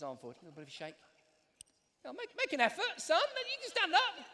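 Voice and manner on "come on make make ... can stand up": high put-on robot voice, pitched well up. [name] voicing Robo [name] to a boy, high robot voice. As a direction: voice the little robot nagging the boy. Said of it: "As I talk, Robo [name]'s mouth actually moves".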